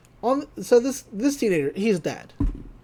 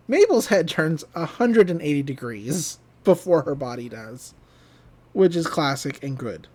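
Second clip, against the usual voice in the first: normal talking voice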